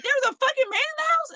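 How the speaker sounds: a mother panicking at the news; alarmed mom. Retold or imitated. Mom hears about intruder.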